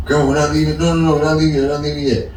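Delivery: normal talking voice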